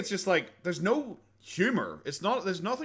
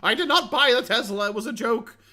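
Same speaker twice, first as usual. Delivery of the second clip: high, loud indignant whine; mock the offended correction, pitched way up; hammed-up defensive Boogie; Boogie having a conniption; 8 semitones higher, 4 dB louder than usual. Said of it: "He's like".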